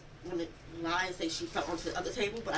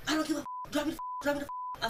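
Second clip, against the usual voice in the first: higher-pitched impatient mimicry; retold agitated demand; 7 semitones higher than usual. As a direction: imitate his insistent demanding.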